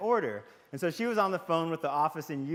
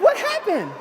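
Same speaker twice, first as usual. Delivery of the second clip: very high, loud squeaky-pitched alarm; startled question; 9 dB louder than usual. Retold or imitated. Husband asking his angry wife, startled and high. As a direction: leap up in pitch, taken aback.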